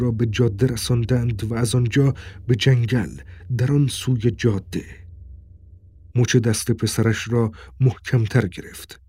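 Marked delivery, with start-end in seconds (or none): none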